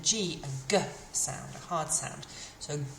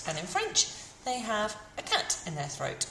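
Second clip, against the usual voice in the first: usual speaking voice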